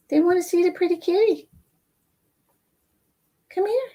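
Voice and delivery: high cooing pet voice. Real, not acted. Coaxing cat into her arms.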